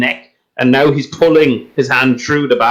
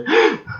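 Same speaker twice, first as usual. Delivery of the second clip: acted gasps for air after being strangled; choked gasping. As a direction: gasp hard as if just released from a chokehold. Choked guard gets his breath back.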